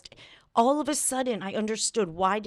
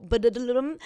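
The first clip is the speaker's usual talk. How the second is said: a weak sung drum roll; badly faked drumroll in mouth sounds; vocal drum roll; 3 semitones higher than usual. Shown as a demonstration. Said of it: "That was not a drum roll".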